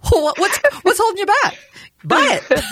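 high, loud laughing quote. Retold or imitated. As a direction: laugh through the pushy question. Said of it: "you went".

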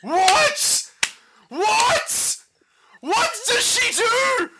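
high, stretched-out shocked cries; shocked disbelief. Real, not acted. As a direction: freak out in total disbelief. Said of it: "You just, like, freaked out".

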